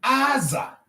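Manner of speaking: Aza said high, falling at the end; high tones on Aza. Shown as a demonstration. Teacher shows Igbo tone, Aza held high. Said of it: "look at their tone marks".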